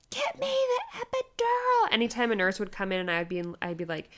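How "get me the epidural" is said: very high, slow strained wail; hammed-up drawn-out squeal; desperate demand. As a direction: beg through labor pain. Demanding epidural in labor.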